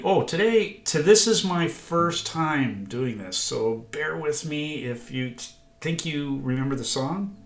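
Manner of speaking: ordinary talk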